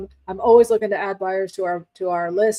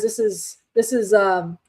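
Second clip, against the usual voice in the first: real halting stumble with repeats and fillers; hesitant false starts, searching for the words; stumbling over words. Real, not acted. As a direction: trip over the words, restarting as you go. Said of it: "I'm stuttering over my words".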